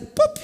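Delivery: loud, high popped "pup"; imitated thud. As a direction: punch out a sudden impact noise. Imitates child hitting ground. (barulho de tombo imitado)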